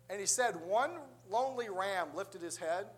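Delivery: usual speaking voice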